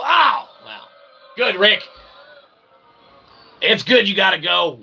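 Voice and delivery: ordinary delivery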